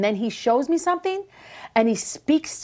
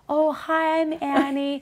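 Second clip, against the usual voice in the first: mock sweet greeting, pitched up; fake polite self; 5 semitones higher, slower than usual. Annie mocks her polite public self, mock sweet voice. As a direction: play the overly nice version of yourself. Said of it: "Then I try to be like".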